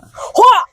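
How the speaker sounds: sharp high hwah punch noise; punch sound. Pretend. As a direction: yell a cartoon punch hit. Mock punch sound effect.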